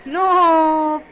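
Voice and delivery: high, loud scoffing "No"; scoffing exclamation. Acted. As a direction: burst out in disbelief. Scoffs at the idea.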